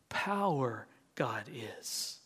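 slow whisper of Power God is. Shown as a demonstration. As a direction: breathe it out slowly, unvoiced. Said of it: "the way Master Yoda speaks".

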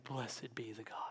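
hushed, reverent whisper. Acted. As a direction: breathe the blessing out. Quoting letter opening, hushed.

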